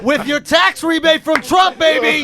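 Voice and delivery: high, drawn-out gleeful holler; mock jubilant shout. Co-host topping the friend's funeral story, mock jubilant shout. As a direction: shout it out like a punchline.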